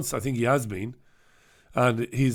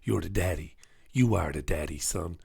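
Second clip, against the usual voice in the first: low muttered self-pep talk; under my breath; 9 semitones lower, 7 dB quieter than usual. Retold or imitated. Runner pepping himself up mid-run, low under his breath. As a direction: mutter it to yourself like a mantra. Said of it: "saying to myself under my breath".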